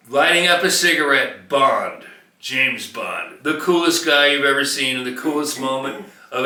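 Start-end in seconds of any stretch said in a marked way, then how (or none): 1.5-3.4 low, smooth spy-hero delivery; James Bond. Retold or imitated. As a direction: say it like a cool secret agent. Quoting James Bond intro.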